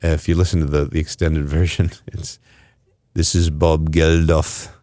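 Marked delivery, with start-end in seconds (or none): 3.1-4.7 slow, lightly put-on [name] voice that drifts foreign; a mock [name] intro, more Russian than English; [name] impression. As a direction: band member's lazy [name] imitation. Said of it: "didn't make it sound like a very English accent".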